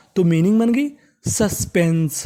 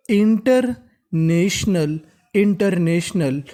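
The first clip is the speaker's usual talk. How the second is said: International stretched slowly, each part clear; teacher models international slowly; slow syllable by syllable; much slower than usual. Shown as a demonstration. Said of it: "तो meaning बनी". (धीरे-धीरे शब्द तोड़कर)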